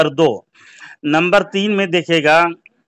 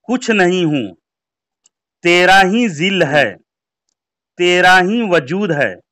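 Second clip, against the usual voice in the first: slow, deliberate reading; slow and careful; 3 semitones higher than usual. Shown as a demonstration. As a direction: read each phrase slowly and clearly. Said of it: "धीमी गति के समाचार में हम बात अर्ज कर रहे हैं".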